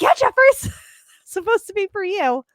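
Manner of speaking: mock cheery reply. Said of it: "I was like".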